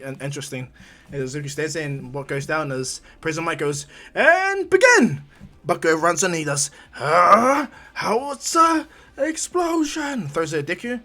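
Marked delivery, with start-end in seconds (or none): 4.1-5.2 long, high, loud starting call; drawn-out arena shout; announcer starting match. Acted. 6.9-10.3 high, slow, drawn-out battle yell; stretched-out anime attack shout; attack cry. Acted. Fighter yells his attack name.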